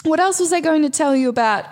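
ordinary talk, nothing marked

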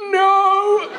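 hammed-up cry of total exhaustion; mock despair. Carpenters giving up at lunchtime over slicing bread, mock-despairing wail.

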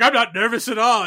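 Rudy protesting he's fine, hammed up; nervous Rudy. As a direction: insist too hard you're calm. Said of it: "he's like".

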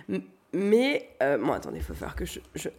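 coughing through the words; coughing fit. Real, not acted. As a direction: voice caught by coughing, then a cough. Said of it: "j'arrête pas de tousser".

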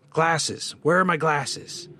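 high, slow, sharp demand; ghostly patient demanding crossly; sharper edge. A narrator's reading. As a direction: pitch it high and draw out the demand. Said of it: "It had taken on a sharper edge".

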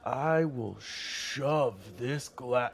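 slow, dragged-out threat; menacing threat. Acted. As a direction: lean on each word to menace. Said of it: "He takes the glass".

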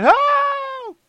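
high desperate cry for help; shout. Pretend. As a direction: yell for help. Pretend call from dying.

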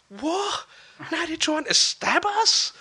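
high, outraged disbelief after being stabbed; shocked disbelief. Retold or imitated. Boy realising the bullies now want to stab him, pitched high in shock. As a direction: hit the top of the voice in disbelief. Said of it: "my thought process was".

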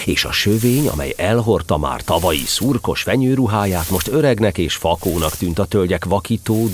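usual speaking voice

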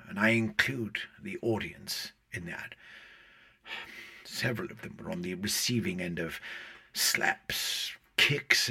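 ordinary talk, nothing marked

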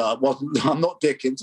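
normal talking voice

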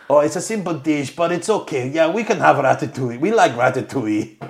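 mock French Swiss guest voice; French Swiss accent. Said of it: "I'm doing French Swiss".

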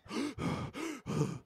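high, sharp gasps for air; acted-out panicked gasps; shocked gasping. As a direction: gasp as if just escaping a crash. Acting out pulling over after near crash.

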